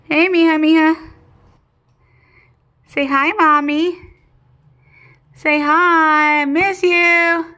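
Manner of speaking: high cooing pet talk. Real, not acted. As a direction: coo at the bird, slow and sweet. Cooing to pet bird on video.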